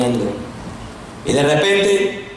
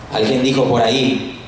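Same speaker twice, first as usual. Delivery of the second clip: usual speaking voice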